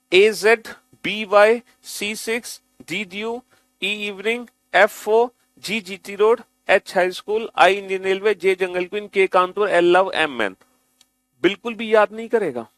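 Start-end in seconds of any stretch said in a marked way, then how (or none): 0.1-10.6 sing-song recital. Shown as a demonstration. Teacher reading the list aloud for students, sing-song. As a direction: recite each pair like a rhyme. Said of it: "कैसे read करना है?".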